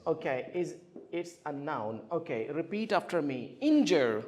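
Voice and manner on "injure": "Injure" with the first syllable stressed, falling. Shown as a demonstration. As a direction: model "Injure" clearly, weight on IN. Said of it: "The first one, right?".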